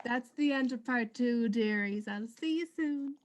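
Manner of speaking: show host sign-off. Acted. Host signs off the episode.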